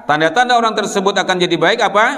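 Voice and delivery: ordinary talk, nothing marked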